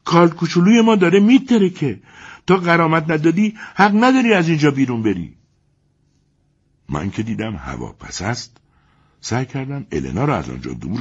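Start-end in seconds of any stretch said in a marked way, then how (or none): 0.0-5.4 much higher, louder indignant wife; shrill-free but loud, demanding compensation; German's wife defending her husband, high and loud. Acted. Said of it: "همسر مرد آلمانی در پشتیبانی از شوهرش گفت".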